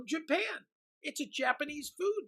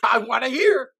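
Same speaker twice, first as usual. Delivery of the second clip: loud, eager demand; excited yell to be told; eager shout; 12 dB louder than usual. Acted. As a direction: shout it out, keen to hear.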